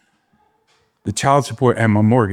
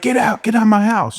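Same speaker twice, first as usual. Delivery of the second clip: high, raised order to leave; throwing him out; 12 semitones higher than usual. Retold or imitated. Friend ordering him out of the house, high and raised. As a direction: bark him out the door, way up in pitch.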